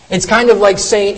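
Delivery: ordinary talk, nothing marked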